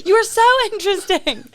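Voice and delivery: mock gushing through laughter; laughing tease. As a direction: gush it while laughing. Teasing with a laugh.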